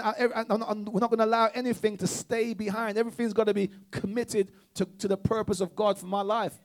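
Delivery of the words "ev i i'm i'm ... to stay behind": normal talking voice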